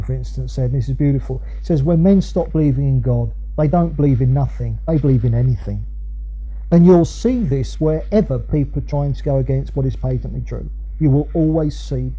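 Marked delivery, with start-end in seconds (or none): none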